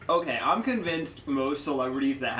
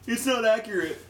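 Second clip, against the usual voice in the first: mock complaint; 9 semitones higher, 4 dB louder than usual. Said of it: "I'm just gonna be like".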